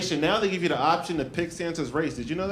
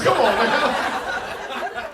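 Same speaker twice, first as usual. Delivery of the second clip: ordinary delivery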